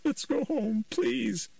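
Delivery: high, slow begging; mother begging her son to come home; desperate mother. A narrator's reading. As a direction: plead on your knees. Nancy begs on her knees.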